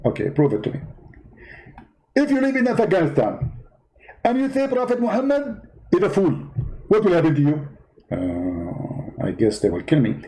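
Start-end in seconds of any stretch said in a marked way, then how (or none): 2.2-7.7 high, indignant preacher voice; Zakir Naik impression. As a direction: play the offended debater, pitched well up. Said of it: "Zakir Naik?".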